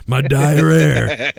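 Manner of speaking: very slow, drawn-out put-on wrestler voice; milk the silly line, stretching the last word; Jake Roberts impression. Repeating the diarrhea gag, slow stretched wrestler impression. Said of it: "it's me, Jake Roberts".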